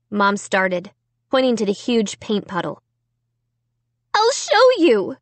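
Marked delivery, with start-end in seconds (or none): 4.1-5.2 high urgent cry. A narrator's reading. Amy insisting to her family, high urgent cry. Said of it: "I cried".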